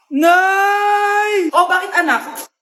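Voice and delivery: tattle at the top of your lungs; crying call to mom. Acted. Child calls mother, tattling. (umiiyak na tawag sa nanay)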